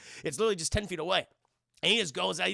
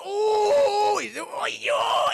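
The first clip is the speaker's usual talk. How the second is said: high, loud cartoon howl; Whitey; 9 dB louder, much slower than usual. Whitey shocked into a seizure, howling high. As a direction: wail "ooh" high and long. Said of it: "And he goes".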